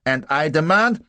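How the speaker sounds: loud, high, insistent; indignant Prussian officer. A narrator's reading. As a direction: demand it as an outraged officer. Indignant Prussian makes demand.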